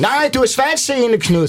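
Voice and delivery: high, slow squeaky mock voice; mock eager advocate. Eye association insisting Knud is partially sighted, high mock voice. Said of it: "Så kommer Øjenforeningen".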